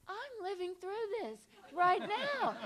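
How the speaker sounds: high, loud, drawn-out lament; hammed-up tearful protest, stretched out; mock crying wail. Actress joining the crying, high mock wail. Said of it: "Everybody's crying".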